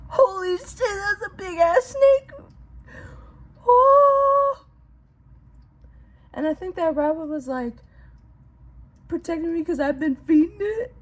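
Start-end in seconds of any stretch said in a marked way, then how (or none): 0.1-2.3 higher, tearful half-laugh; laughing through tears. Real, not acted. Retelling the snake fight, tearful. 3.7-4.7 high, louder gasp; overwhelmed gasp. Real, not acted. Overwhelmed after snake story. 9.1-10.9 a little higher, laughing and tearing up; moved almost to tears; teary laugh. Real, not acted. Tearful about the rabbit.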